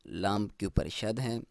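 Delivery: usual speaking voice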